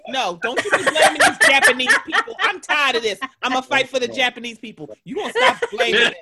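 loud, laughing fake indignation; playful mock defense, laughing; laughing mock outrage. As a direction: rant in jest while laughing. Mock defending Japanese people.

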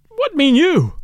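high, loud cry of alarm; startled cry. A narrator's reading. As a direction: cry out the question, demanding an answer. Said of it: "I cried".

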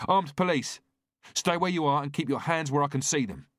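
loud, high shouted command; armed cop shouting a warning; police shout. A narrator's reading. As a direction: yell the order down the corridor. Officer shouts at fleeing man.

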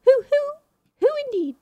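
high hooting who who; playful owl hoots. Pretend.

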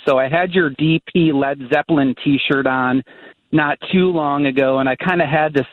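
ordinary talk

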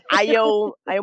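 usual speaking voice

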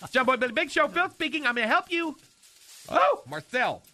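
perky phone greeting. Acted. Answering the phone in character.

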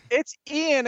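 "Ian" said as "Eye-an" with a sudden high pitch; high-pitched "Ian" pronounced "Eye-an"; correct name pronunciation. Shown as a demonstration. Cohost correcting the name, high bright "Eye-an". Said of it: "Like Iron Eagle".

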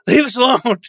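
grand theatrical high voice; hammy stage actor. Acted. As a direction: declaim it like bad Shakespeare. Shakespeare-class character declaiming.

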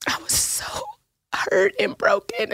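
real tears breaking through the words, voice nearly gone; fighting back real crying as she thanks her. Real, not acted. Thanking her friend for being there, real crying. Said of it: "now I'm gonna cry again".

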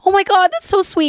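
high-pitched acted-out gush; touched gushing reaction. Comedian hugging a crying fan, high re-enacted gush. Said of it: "orgasms where you're like".

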